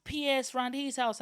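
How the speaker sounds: put-on high, eager kid voice asking about games; mocking classmates. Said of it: "everyone else is like".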